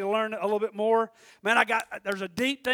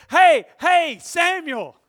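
raised, higher calling voice; acted calling out; 4 semitones higher, 8 dB louder, much slower than usual. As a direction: call to rouse a sleeper. Acts out God calling sleeping Samuel.

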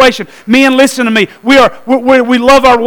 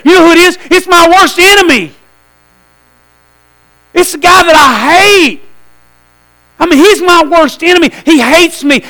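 rising outrage; 5 semitones higher than usual. Real, not acted. Preacher revealing wife's visitor is his enemy, higher and indignant. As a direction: hit the reveal with alarm.

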